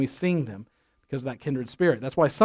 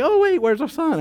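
surprised parent; 9 semitones higher than usual. Retold or imitated. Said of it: "And like".

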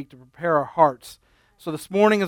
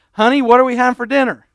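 calling out; 6 semitones higher, 5 dB louder than usual. Retold or imitated. Husband tests wife's hearing.

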